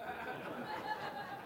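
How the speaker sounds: airy, high laugh; breathless laugh. Real, not acted. Narrator cracking up at dad's boast, breathless laugh. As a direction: laugh silently at the punchline.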